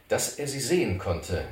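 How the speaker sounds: plain reading voice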